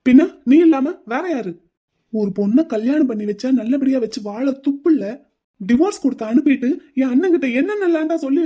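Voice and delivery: angry accusation. Acted. Groom accusing his friend of poisoning his brother, angry. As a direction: pile the blame on him.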